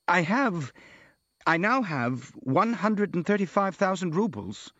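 falter, then state it sheepishly; shy, halting reply; embarrassed mumble. A narrator's reading. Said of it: "mumbled the prince, reddening".

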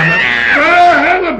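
high, loud shriek for help; scream. Acted. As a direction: scream out in sudden alarm. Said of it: "Nou, ik gil".

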